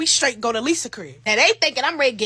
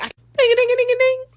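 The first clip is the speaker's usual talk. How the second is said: high ringing phone sound; high mock ringtone; imitated phone ring; 11 semitones higher, 6 dB louder, faster than usual. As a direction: sing it like a ringtone.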